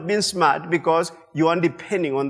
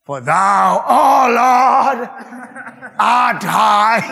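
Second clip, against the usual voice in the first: loud, crying, straining sung line; throat-straining cry; 3 semitones higher, 5 dB louder, much slower than usual. Shown as a demonstration. Pastor shows singing that costs the voice, a loud strained cry. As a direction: belt it from the throat till it cracks. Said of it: "You sing that, you don't have much".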